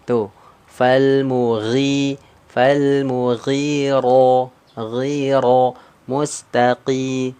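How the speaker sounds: mustaqim drawn out slowly for the class; slow recitation demo. Shown as a demonstration. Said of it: "tebal atau tipis?". (mustaqi dibaca pelan dan dipanjangkan)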